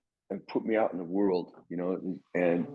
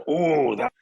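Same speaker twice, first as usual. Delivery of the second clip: Ronnie's delighted exclamation, higher and louder; a mild mock of an excited mentor; impressed exclamation; 3 semitones higher, 4 dB louder, slower than usual. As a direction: imitate him lighting up at the solo. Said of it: "he was like".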